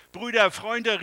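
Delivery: loud call across the street. A narrator's reading. The man calls his friends to help clean up. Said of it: "rief er".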